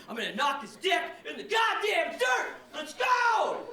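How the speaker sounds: high, rising hype shout; pumped-up yell. Retold or imitated. Comic pumping himself up for a fight, high hype shout.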